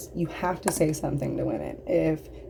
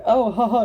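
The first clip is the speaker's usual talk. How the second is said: sarcastic laugh; 5 semitones higher, 6 dB louder than usual. Real, not acted. Mocking laugh at the joke.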